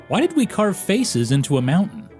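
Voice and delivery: ordinary delivery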